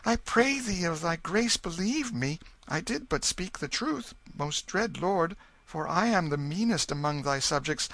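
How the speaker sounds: put-on boy begging the king to spare him; begging pauper boy. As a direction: plead for your life. Tom begs king for life.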